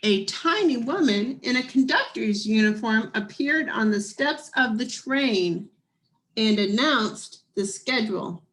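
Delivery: lively storybook reading voice; story narration. A narrator's reading. Reading a picture book aloud.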